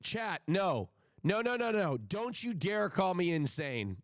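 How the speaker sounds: ordinary talk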